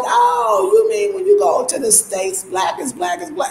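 high, wide-eyed mock surprise; mock naive Ghanaians. Ghanaians back from abroad realizing, high mock astonishment. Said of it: "they was like".

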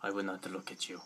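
low, quiet sulking robot character. Acted. Toy robot snubbing its owner, low sulky voice. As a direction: refuse in a soft, low put-on accent. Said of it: "I picture the B B eight voice like this".

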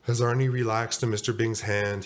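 ordinary delivery

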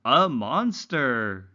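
"A monster" said slowly, every sound clear for repeating; slow model word. Shown as a demonstration. Teacher modelling "monster" slowly for learners to repeat. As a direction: spell out "monster" slowly for the class to copy. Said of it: "Say it after me".

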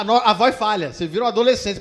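ordinary talk, nothing marked

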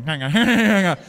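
mocking the class, laughing; flustered kids. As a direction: imitate the class trying to keep up. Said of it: "You're going".

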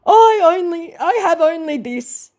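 high, hammed-up whine; mock lament. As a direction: lament pitifully in a put-on voice. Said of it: "he laments".

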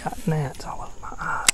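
soft whispered complaint; whispering. Real, not acted. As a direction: keep it to a whisper. Gnats in his eyes, filming.

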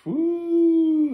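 high excited woo. Real, not acted. Vlogger hyped about the battle's potential, high woo.

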